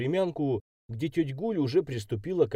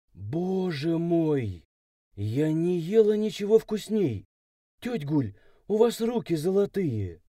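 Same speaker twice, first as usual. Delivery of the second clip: gushing praise; 7 semitones higher than usual. A narrator's reading. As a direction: gush over the cook as the girl. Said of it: "нахваливала повариху".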